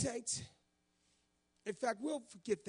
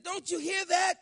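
high, loud and insistent; exasperated loud question; 9 semitones higher, 9 dB louder than usual. Retold or imitated. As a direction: demand he notice the noise. Wife insisting on the noise.

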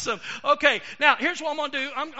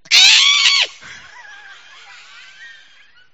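high loud squealing shriek; shrieking puppet scream; 18 dB louder than usual. Acted. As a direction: let out a piercing squeal. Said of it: "And Stinky went".

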